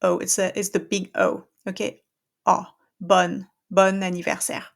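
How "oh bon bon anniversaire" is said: bon anniversaire with the open O and linked N; French liaison. Shown as a demonstration. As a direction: carry the N of bon across. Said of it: "open that O".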